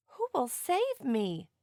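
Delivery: put-on a lost baby begging for rescue; crying out. A narrator's reading. Stranded baby whale calling for help, slow and pleading. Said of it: "he cried".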